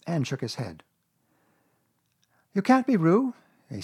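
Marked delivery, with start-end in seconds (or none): 2.5-3.3 put-on high, loud child's voice; boy Christopher Robin. A narrator's reading. Christopher Robin judging Piglet, high boyish certainty.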